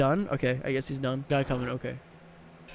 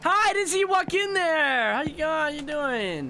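much higher, put-on polite greeting; high friendly voice; 9 semitones higher than usual. Gamer greeting a startled customer in a high put-on voice.

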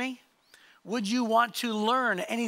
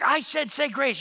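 higher, insistent mock scolding; upset mom; 5 semitones higher than usual. Mom repeats her order to her sons, imitated upset. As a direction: imitate a mother losing patience. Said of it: "she gets really upset".

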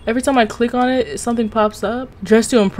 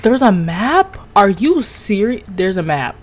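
ordinary delivery

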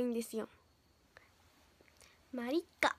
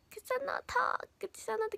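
whisper; 6 semitones higher, 4 dB quieter than usual. Real, not acted. Reading name softly.

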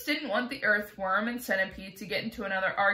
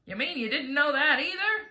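high-pitched, mocking disbelief; scornful; 5 semitones higher, 4 dB louder than usual. A narrator's reading. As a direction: rub it in with a scornful question. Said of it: "the centipede said scornfully".

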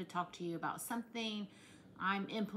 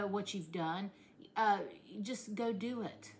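normal talking voice

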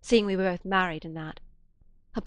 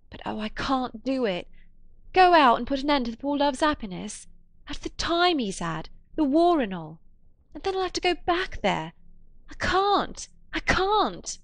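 much higher and louder, near tears; distressed outburst; 7 semitones higher, 5 dB louder than usual. A narrator's reading. Woman cries out she cannot end his happiness, much higher and louder. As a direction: break into anguished protest.

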